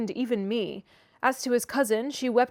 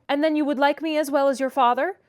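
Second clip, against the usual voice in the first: more cheerful; 4 semitones higher, 6 dB louder than usual. A narrator's reading. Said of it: "observed he more cheerfully".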